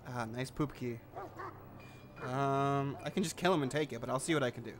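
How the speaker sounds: ordinary delivery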